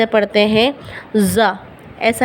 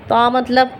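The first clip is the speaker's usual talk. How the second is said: ordinary talk, nothing marked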